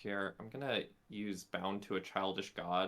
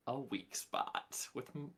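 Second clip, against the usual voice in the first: ordinary talk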